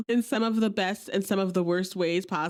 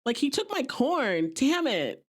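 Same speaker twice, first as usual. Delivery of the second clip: hammed-up outrage, pitched higher and slowed; playing it up; 7 semitones higher, slower than usual. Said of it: "So she plays it up".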